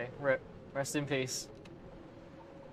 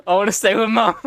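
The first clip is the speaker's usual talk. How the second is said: put-on higher, louder, laughing through a childish line; laughing repeat; 7 semitones higher, 10 dB louder than usual. Player repeating the character's line, laughing. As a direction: giggle while whining like a child.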